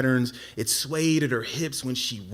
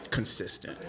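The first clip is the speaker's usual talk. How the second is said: low, soft, sheepish punchline; awkward repetition; 7 semitones lower, 8 dB quieter than usual. Retold or imitated. Nervous man repeating himself to the girl, awkward repetition. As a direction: drop it low after a pause, nearly voiceless. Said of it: "I'm repeating myself".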